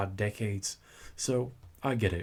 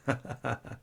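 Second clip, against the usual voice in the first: teasing laugh; 3 dB quieter than usual. Acted. Seductive demon teases summoner.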